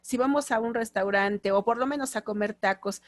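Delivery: usual speaking voice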